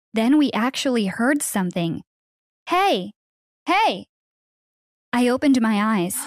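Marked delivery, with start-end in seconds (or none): 2.7-4.1 higher-pitched distant-rescuer call; acted "hey" call, pitched up. A narrator's reading. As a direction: call out to find someone. A rescuer calls from outside.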